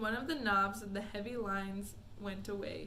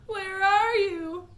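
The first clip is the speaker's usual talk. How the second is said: calling out; 10 semitones higher, 11 dB louder, slower than usual. Acted. Mother calling for lost son.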